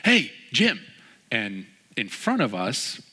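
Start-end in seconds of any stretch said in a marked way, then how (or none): 0.0-0.8 high, loud imitation of hailing someone; calling out. Ken hails his friend Jim in line, calling out. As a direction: call out playfully to get a friend's attention. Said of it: "very demonstrative person and super entertaining".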